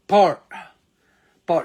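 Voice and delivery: Par with a strong aspirated P; Par, pushed out loud with air; drilled Par. Shown as a demonstration. Teacher drilling Par for students. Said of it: "شايف الصوت الإق ده".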